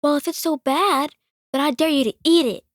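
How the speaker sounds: high, loud dare; defiant little girl. Retold or imitated. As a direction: challenge him like a spunky kid. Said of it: "And she just goes".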